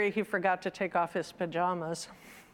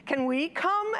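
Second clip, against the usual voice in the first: put-on children's plea; 8 semitones higher, 3 dB louder than usual. Children begging to follow mother.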